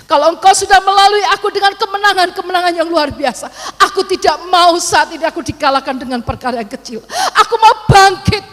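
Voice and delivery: high, loud declaration to God; determined prayer. Retold or imitated. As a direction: pray out loud with fierce resolve. Retelling her resolute prayer to God. (doa lantang penuh tekad)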